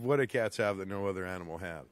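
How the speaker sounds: low fast mumble. Acted. Repeats riddle in another voice.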